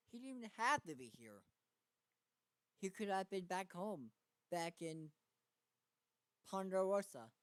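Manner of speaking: frustrated. A narrator's reading. Character's frustrated inner thoughts.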